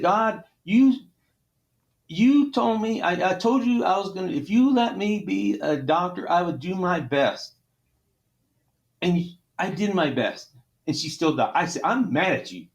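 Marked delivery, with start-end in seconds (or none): 0.0-11.5 crying out at God, choked up and raw; grieving surgeon shouting his anger at God; emotional outburst. Real, not acted. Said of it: "I'm a little emotional". 11.8-12.7 crying out at God, choked up and raw; grieving surgeon shouting his anger at God; emotional outburst. Real, not acted. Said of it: "I'm a little emotional".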